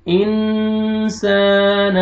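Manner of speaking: इंसान with the sound carried into the nose; nasalized insaan. Shown as a demonstration. Teacher demonstrating nasal ghunna to students. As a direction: draw out इंसान slowly through the nose. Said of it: "नोज में sound लेके जाना है".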